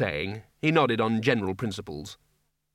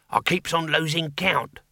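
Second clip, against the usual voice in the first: higher-pitched little demon voice; squeaky demon; 5 semitones higher than usual. Acted. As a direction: squeak it like a put-upon imp. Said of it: "squeaked the demon".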